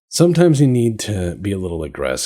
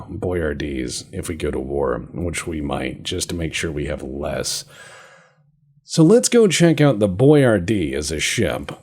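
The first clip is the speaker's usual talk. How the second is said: usual speaking voice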